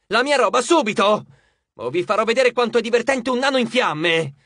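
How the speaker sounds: high, loud furious demand; fed-up, shouted threat; angry shout. A narrator's reading. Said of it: "gridò".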